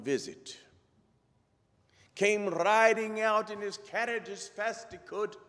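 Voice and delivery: storyteller in a put-on Scottish brogue; hammed-up Scottish storytelling. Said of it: "best Scottish brogue I can come up with".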